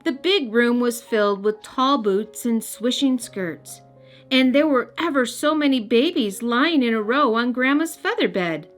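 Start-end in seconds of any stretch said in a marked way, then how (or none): none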